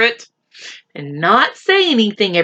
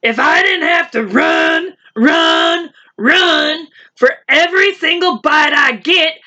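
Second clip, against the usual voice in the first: raised, higher whiny lion voice; complaining lion; 8 semitones higher than usual. Acted. Hungry lion complaining to the animals, higher whine.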